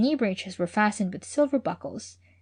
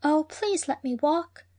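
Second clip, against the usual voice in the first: pleading; 7 semitones higher, slower than usual. A narrator's reading. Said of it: "entreated Hanny".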